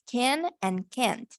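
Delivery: slow, clear "can and can't"; careful demo of can and can't. Shown as a demonstration. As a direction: spell out "can and can't" slowly. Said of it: "cómo pronunciar esas dos palabras".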